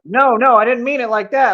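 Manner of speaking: mock-panicked protest; mildly alarmed backpedal. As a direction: take it back before someone gets offended. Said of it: "afraid that Superman might trip".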